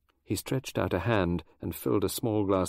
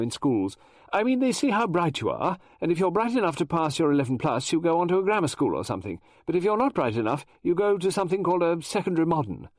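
higher, louder flustered lady novelist; Mrs Oliver voice; 8 semitones higher, 4 dB louder than usual. Acted. Mrs Oliver explaining schools to Poirot, high and eager. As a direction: chatter on like an excitable Englishwoman.

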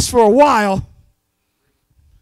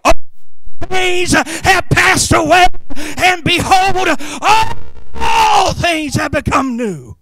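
proclaiming scripture; 5 semitones higher, 3 dB louder, slower than usual. Retold or imitated. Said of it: "then you can say".

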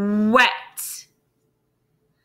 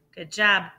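usual speaking voice

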